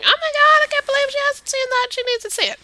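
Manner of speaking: high, gushing fan voice; excited genre fans. Acted. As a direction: mimic fans squealing in disbelief. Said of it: "fans of the genre are probably also going".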